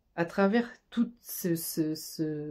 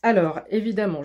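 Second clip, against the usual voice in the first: ordinary talk, nothing marked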